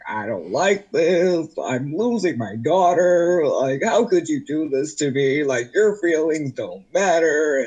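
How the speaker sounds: a hint of a wounded father; upset dad. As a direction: imitate dad going off, whiny and hurt. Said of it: "he went off and he's like".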